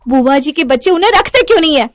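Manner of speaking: high, loud demand; shouting in anger. Acted. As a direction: yell it mid-row with your husband. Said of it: "चीखने चिल्लाने की आवाज". (चिल्लाकर ग़ुस्से में सवाल)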